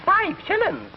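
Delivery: very high, incredulous Scots squawk; outraged Scottish accent. Acted. Scotsman shocked at the fare, outraged Scottish accent. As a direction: balk at the price in a high Scots voice. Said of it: "And the Scotch says".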